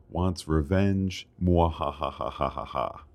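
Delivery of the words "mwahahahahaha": evil cackling mwahahaha; mad scientist. Pretend. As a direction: cackle like a scheming villain. Villain laugh after "Ward wants revenge.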